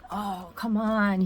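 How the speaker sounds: drawn-out, exasperated retold groan. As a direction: relive being fed up, stretching the words. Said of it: "I was so angry about it".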